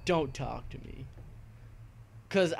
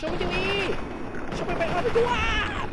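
much higher, louder, slow mock chant; mock gibberish enemy; 7 dB louder, much slower than usual. Gamer mimicking an enemy soldier's shouts, high and hammed-up. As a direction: stretch the nonsense out high and loud. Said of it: "That's what he just said".

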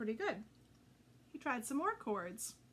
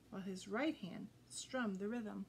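usual speaking voice

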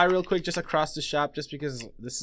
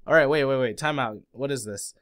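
ordinary delivery

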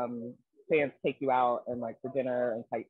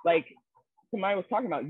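ordinary delivery

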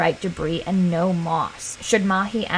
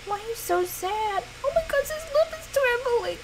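cooing sympathy; 11 semitones higher, 6 dB quieter than usual. Real, not acted. Gamer cooing at her sad Sim, tender. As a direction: melt over a sad little face.

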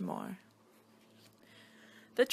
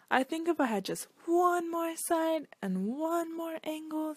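much higher put-on wishful voice; hopeful shape voice; 8 semitones higher than usual. Quadrilateral asks the shapeshifter for one more side, high and pleading.

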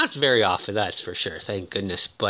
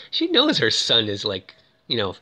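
ordinary talk, nothing marked

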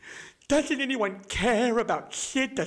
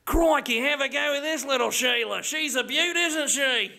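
excited, higher Aussie voice; Australian accent; 3 semitones higher than usual. Shown as a demonstration. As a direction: enthuse like an Australian outdoorsman. Australian turn in accent demo.

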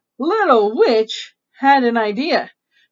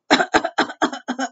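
demonstrated cough; 2 semitones higher than usual. Shown as a demonstration. Reader showing what a cough is, mock coughing. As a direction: run of short fake coughs. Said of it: "that's a cough".

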